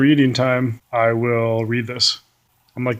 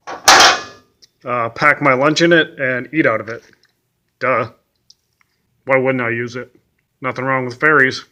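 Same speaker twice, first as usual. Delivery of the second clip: louder, mock-obvious sarcastic answer; sassy duh; 5 dB louder than usual. Answering his own question about the lunchbox, sarcastic and loud.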